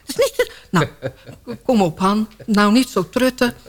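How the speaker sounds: ordinary talk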